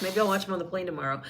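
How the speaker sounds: ordinary delivery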